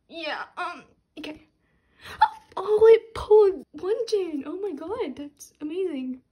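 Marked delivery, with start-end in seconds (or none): 2.0-2.5 loud surprised gasp; sharp gasp. Real, not acted. Fan gasping at a rare pull, sharp and loud. 2.6-4.4 high, loud laughing squeal; thrilled squeal. Real, not acted. Fan shrieking with joy on pulling [name]. Thrilled at rare card pull.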